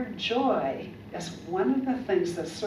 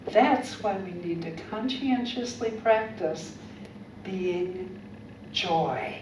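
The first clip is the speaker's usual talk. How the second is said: ordinary talk, nothing marked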